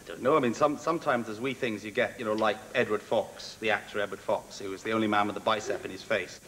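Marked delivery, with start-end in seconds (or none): none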